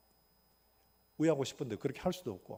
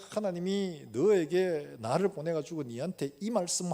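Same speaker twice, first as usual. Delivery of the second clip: preaching with force; 3 semitones higher, 5 dB louder, slower than usual. Acted. Preaching to the cellmate.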